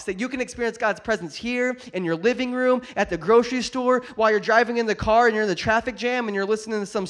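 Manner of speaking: loud, driving sermon delivery; impassioned preaching. Real, not acted. Preacher tells congregation where God's presence is, impassioned preaching. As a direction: preach it out with force.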